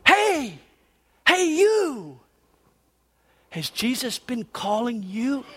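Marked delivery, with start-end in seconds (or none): 0.0-2.2 high, drawn-out call; high hailing voice, trying to get attention. A narrator's reading. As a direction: call to someone a way off. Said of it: "out on the edge calling".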